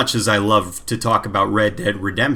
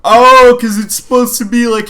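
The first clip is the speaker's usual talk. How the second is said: sudden high, loud burst of recognition; excited realization; 12 semitones higher, 8 dB louder than usual. Real, not acted. Friend suddenly remembering the game's point, high excited. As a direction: pop up high as it clicks.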